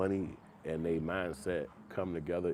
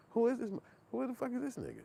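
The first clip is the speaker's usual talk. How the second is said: high-pitched incredulous squeak; baffled disbelief; faster than usual. Retold or imitated. Viewer baffled by unknown star, high incredulous squeak. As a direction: shoot way up in disbelief. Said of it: "'Cause I'm like".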